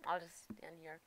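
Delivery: whisper. Real, not acted. Whispering while hiding.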